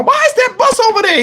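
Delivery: high-pitched mock alarm; alarmed questioning. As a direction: imitate her startled question. She questions him, retold.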